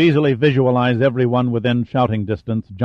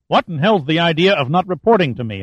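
angry charge; 6 semitones higher than usual. A narrator's reading. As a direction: demand it like a furious admiral. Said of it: "Hunter charged".

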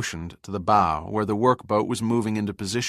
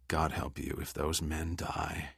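steely threat; 3 semitones lower, 8 dB quieter than usual. A narrator's reading. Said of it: "in a voice that could have cut steel".

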